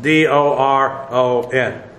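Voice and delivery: D O R O N spelled slowly, each letter separate. Shown as a demonstration. Teacher spells the Greek word for the class, letter by letter. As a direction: spell doron one letter at a time, slowly. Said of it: "and then doron".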